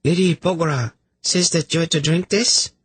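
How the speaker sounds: very high, sweet female voice; high-pitched, politely innocent messenger; chirpy lady. Acted. Lady offering a drink to sufferers, sweetly chirpy.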